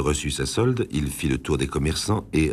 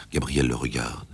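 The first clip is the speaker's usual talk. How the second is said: usual speaking voice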